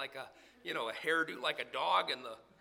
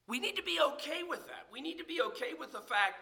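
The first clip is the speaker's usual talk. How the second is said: higher, quicker insistence; urgent; 11 semitones higher, faster than usual. Acted. Preacher pressing the point on his flock, higher and quick. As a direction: push it up and fast. Urging congregation, sudden lift.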